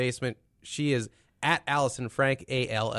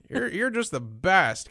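slow mock fawning; 6 semitones higher, slower than usual. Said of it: "Everyone's like".

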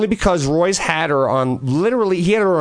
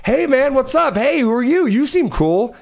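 friendly dog voice; 10 semitones higher than usual. Retold or imitated. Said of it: "They were just like".

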